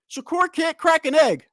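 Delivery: high, loud mock taunt; mocking fan taunt. Streamer imitating boastful fans on stream, loud mock taunt. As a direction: throw the fans' jeer back at them. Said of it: "Followed up with".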